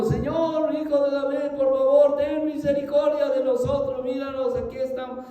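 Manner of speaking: high, half-sung begging call; pleading cry. A narrator's reading. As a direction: plead with Jesus to be seen. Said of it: "estos ciegos estaban gritando".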